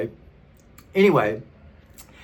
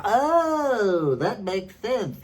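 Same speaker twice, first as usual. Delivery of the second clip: drawn-out, high-pitched mock "oh"; mock realization; 8 semitones higher, much slower than usual. Imagined opponent suddenly won over in an argument, mock realization. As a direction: pretend it has suddenly dawned on you.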